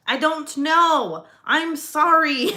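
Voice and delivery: high, slow, mock-guilty apology; sheepish apology. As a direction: play the guilty wife, drawn out and high. Retold apology to scared husband.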